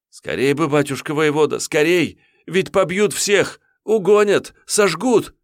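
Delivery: высокий, громче, на грани плача; умолять спасти деревню; отчаянная мольба отрока. Acted. (отрок в панике молит воеводу)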